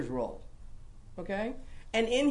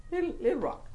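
higher, small voice on the little rock; tiny little voice; 8 semitones higher, faster than usual. Shown as a demonstration. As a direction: make the rock sound tiny. Playing up the smallness of the stone.

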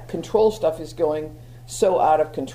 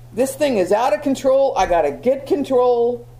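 higher, intense reenacted outburst; mock-frantic grab for control, raised in pitch; voice going up; 5 semitones higher than usual. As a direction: let the pitch climb as you act out losing control. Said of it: "your voice goes up, the volume goes up, the intensity".

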